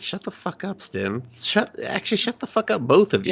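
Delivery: high, exasperated mock outburst. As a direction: squeal it like you've had enough of both. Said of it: "And I was like".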